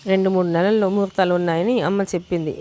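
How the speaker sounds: ordinary delivery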